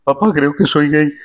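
Felipe impression again. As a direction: repeat the punchline as the shy prince. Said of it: "ese chiste es muy antiguo".

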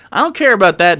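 ordinary talk

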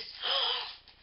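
short, sharp gasp of delight; surprised gasp. Real, not acted. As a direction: gasp at a sudden discovery. Discovers another item in parcel.

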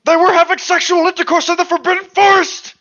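high, loud, fast outburst; hammed-up angry shrieking; furious yell. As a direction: scream the accusation at full tilt. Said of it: "He yelled".

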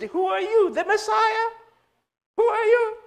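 very high-pitched woman's astonishment; amazed. Acted. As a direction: gasp it out in a high put-on voice. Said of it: "she was amazed".

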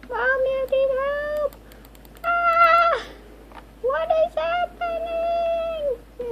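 high, loud wailing panic; panicked cry for help. Real, not acted. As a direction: scream for mommy in mock terror. Panicking as game goes wrong.

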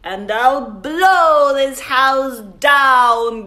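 booming storybook wolf; growling wolf. Acted. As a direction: growl it out like the big bad wolf. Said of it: "growled the wolf".

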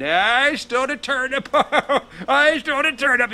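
high, gleeful singsong taunt with a laugh; mocking taunt. A narrator's reading. Jack taunts the poor family over the stolen turnip, mocking. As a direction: jeer at them like a smug thief. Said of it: "he starts mocking the family".

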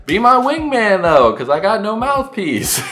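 high-pitched, pumped-up acted reply; eager agreement. Asking friends to be wingmen, high excited plea. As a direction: get carried away, pitch way up.

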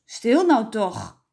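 loud, drawn-out hushing order; exasperated father quieting children; father calling out. A narrator's reading. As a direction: call for silence, slowly and loudly. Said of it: "riep vader Stamper".

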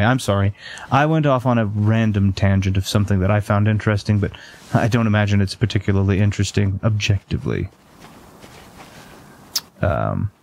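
ordinary delivery

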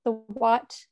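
usual speaking voice

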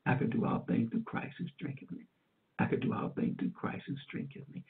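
breathy whisper, repeating the verse over and over; barely voiced, clinging to the words; whispered recital. Recovering from a stroke, whispering the verse to herself.

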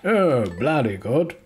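hammed-up British gent accent, swooping pitch; mock an old-chap Englishman, drawing out the last line; przerysowany brytyjski dżentelmen. Posh British caricature in quotes.

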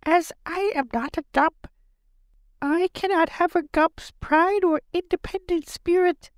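very high, squeaky creature voice, resigned; a creature resigned to servitude; gloomy Gump. A narrator's reading.

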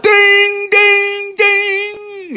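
high sung ding-ding of the bell; lunch bell. Retold or imitated. Imitating the factory lunch bell, high and ringing.

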